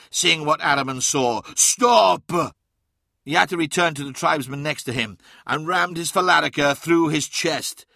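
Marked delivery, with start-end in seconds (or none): 1.5-2.6 loud, high shouted command; urgent alarmed yell; crying out. A narrator's reading. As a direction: shout to halt them. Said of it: "cried Magnus".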